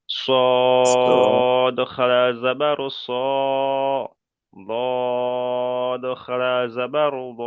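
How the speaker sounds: "সদ" and "দদ" held very long on a level note; very slow, draw out the vowel of "সদ" and "দদ" before closing; three-alif stretch. Shown as a demonstration. Teacher showing pupil the stretch, "সদ" held very long. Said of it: "তিন আলিফ পরিমাণ করে টান".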